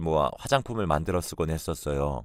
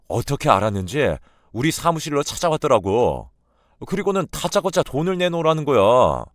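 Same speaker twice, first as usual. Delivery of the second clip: loud and high, fuming retelling; indignant husband; 4 semitones higher, 6 dB louder than usual. Acted. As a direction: spit out the mother-in-law's demand in outrage. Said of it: "불같이 화를 내며". (장모의 요구를 전하며 분개하는 남편)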